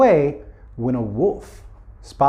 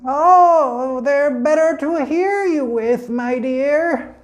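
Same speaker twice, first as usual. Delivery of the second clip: put-on high, slow old-lady voice; wolf as grandmother; 10 semitones higher, much slower than usual. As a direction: stay high and slow, sweetly pretending. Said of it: "And the wolf said".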